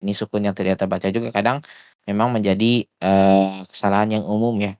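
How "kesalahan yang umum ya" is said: ordinary delivery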